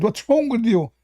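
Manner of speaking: high, emphatic "Absolutely"; mock insistent reply. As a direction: play the sure, smug opponent. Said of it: "He said".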